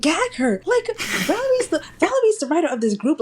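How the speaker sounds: high-pitched fan gushing; excited gush. Real, not acted. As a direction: squeal it out in delight. Gushing over Valerie.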